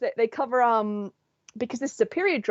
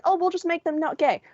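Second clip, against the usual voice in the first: mock censors; 8 semitones higher than usual. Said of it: "So they're like".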